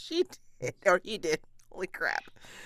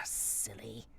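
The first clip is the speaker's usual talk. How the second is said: whispered tease; 9 semitones lower, 14 dB quieter than usual. Acted. Whispered teasing aside.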